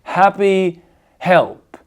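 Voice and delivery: "Happy help" slowly, each H breathed out; aspirated H. Shown as a demonstration. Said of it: "ils sont aspirés".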